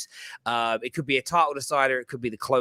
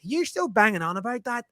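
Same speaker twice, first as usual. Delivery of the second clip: put-on higher Northern Irish child voice, repeated; Northern Irish accent; 9 semitones higher than usual. Retelling his son's jab, in a lighter Northern Irish accent. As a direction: lightly redo the boy's Northern Irish tease. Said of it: "In his thick Northern Irish accent".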